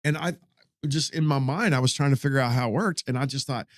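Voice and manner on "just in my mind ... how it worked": usual speaking voice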